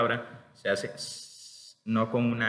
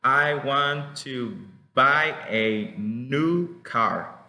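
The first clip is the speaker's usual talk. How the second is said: very slow, word by word; slow careful classroom read; slow example sentence; 3 dB louder, much slower than usual. Shown as a demonstration. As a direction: spell the sentence out for learners. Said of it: "For example".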